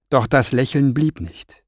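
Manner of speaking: ordinary delivery, nothing marked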